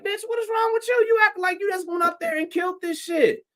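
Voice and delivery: telling off the booker about her own bad set, high and sassy; mock rant at her. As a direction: cut her down in a high, mocking tone.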